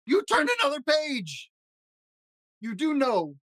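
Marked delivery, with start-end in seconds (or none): 0.1-1.5 high, slow, aghast cry; shocked outcry. Acted. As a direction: gasp it out, appalled. Grover dismayed again.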